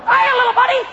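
squeaky, very high cartoon greeting; Mickey Mouse. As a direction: shout hello in a high falsetto. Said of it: "Mickey Mouse walks up".

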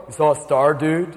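low, lazy stoner wise man; stoned wise man. Retold or imitated. Wise men greeting the pregnant wife.

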